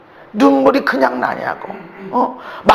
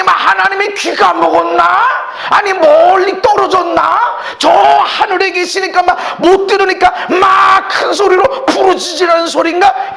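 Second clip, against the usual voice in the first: full-force sermon shouting; fiery preaching; 6 semitones higher than usual. Acted. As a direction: preach it hard, voice raised high.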